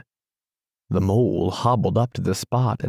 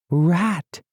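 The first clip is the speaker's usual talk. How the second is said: penitent cry; 9 semitones higher than usual. A narrator's reading. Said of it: "he cried in penitence".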